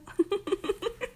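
high, tittering giggle; delighted giggle. Acted. Hearing the crush has fallen too, high delighted giggle. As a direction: giggle in a high, rising burst.

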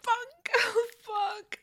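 high, strained cries of pleasure; climax cries. Acted. A mistress crying out as she climaxes. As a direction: pitch up and cry out.

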